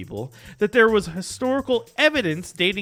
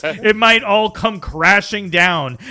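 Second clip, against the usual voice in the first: dramatic; 3 semitones higher, 6 dB louder than usual. Pretend. Dramatic doom speech about Rogan.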